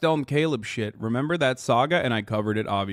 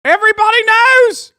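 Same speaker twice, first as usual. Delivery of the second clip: loud shout; 10 dB louder than usual. Pretend. Mock rant punchline.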